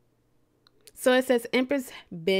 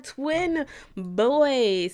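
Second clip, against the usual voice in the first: ordinary delivery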